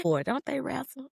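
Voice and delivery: ordinary delivery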